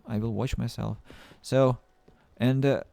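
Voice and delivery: usual speaking voice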